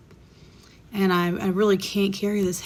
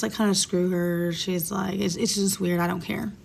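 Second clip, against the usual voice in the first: ordinary talk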